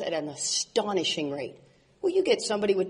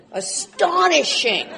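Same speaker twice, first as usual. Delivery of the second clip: "Astonishing" drawn out slowly, higher and louder; emphatic repeat; 7 semitones higher, 3 dB louder, slower than usual. Shown as a demonstration. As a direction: lean on "Astonishing", stretch every syllable.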